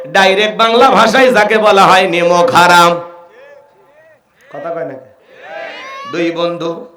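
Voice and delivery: normal talking voice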